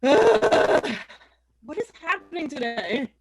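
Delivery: ordinary delivery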